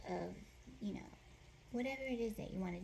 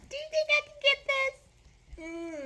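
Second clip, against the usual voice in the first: much higher, louder shopper voice; mock shopper; 6 dB louder than usual. Imitating herself shopping, high and loud. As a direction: ask like an eager shopper. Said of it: "when you go shopping".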